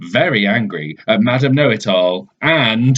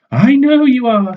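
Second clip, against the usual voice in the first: high, louder know-it-all voice; smug and certain, pitched way up; Madam Know-It-All; 14 semitones higher, 5 dB louder than usual. Acted. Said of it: "says Madam Know-It-All".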